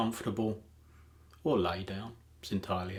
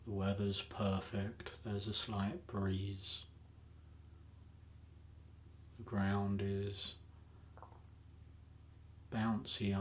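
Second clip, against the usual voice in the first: unhurried meditation voice with long pauses; calm, even hypnotic lead-in, phrase by phrase; guided meditation; 6 dB quieter than usual. Acted.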